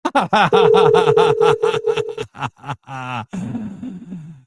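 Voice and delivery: loud, long burst of laughter; long real laugh. Real, not acted. Host cracks up at a listener's comment, laughing loudly.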